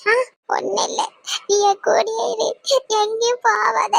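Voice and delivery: high, tearful clinging plea; crying. Acted. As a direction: plead through sobs to your mother. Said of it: "என் பிள்ளை அழுதுகிட்டு இருக்கு". (அழுதுகொண்டே கெஞ்சல்)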